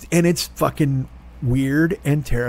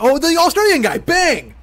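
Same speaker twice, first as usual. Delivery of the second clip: suddenly much higher and louder, triumphant; excited outburst; 11 semitones higher, 4 dB louder than usual. Real, not acted. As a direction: burst out as the memory hits you. Sudden excited recall of story.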